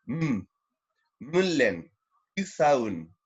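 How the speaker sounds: Mulen with a held M, Kusaun with a hard K; marked first consonant. Shown as a demonstration. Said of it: "se marca el sonido".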